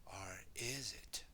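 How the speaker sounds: slow, hushed doubt; ominous whisper. Acted. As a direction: whisper it slowly to sow suspicion.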